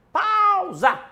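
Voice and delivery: sudden loud high call; shouted. Real, not acted. Host shouting to the producer to pause the replay. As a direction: yell it out to freeze the screen.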